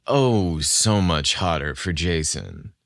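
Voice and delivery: ordinary delivery, nothing marked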